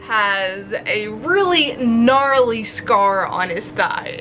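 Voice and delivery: ordinary delivery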